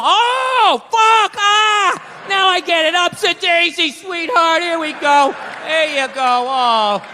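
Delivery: mock a dim brute getting it at last; mock realization. Wife beater watching TV suddenly gets the message, goofy shout.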